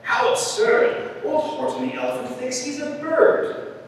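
high, mocking jeer; taunting yell. A narrator's reading. Friends taunt elephant on the nest, yelling. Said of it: "They yelled".

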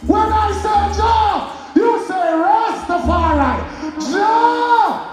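hyped crowd warm up. Acted. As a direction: shout it to the crowd. Call and response to crowd.

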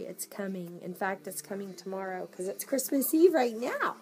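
ordinary delivery

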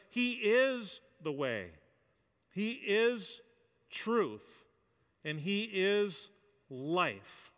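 IS gedehnt und betont, langsam; stress on IS. Real, not acted. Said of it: "Those are important statements".

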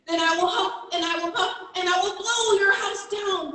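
high, loud mock growl of a threatening wolf. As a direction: threaten like a hammed-up storybook wolf. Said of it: "growled the wolf".

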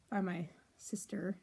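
whisper. Real, not acted. Whispers because sister near.

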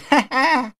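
smug snicker. Acted. Thug sneers at the pharmacist.

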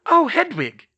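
high, loud realisation; exclaimed. A narrator's reading. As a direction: exclaim as you suddenly remember. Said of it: "Hermione exclaimed".

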